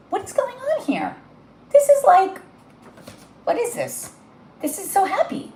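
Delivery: can't believe how happy this card is; high, hushed amazement; overwhelmed surprise. Real, not acted.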